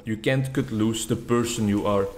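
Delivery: ordinary talk, nothing marked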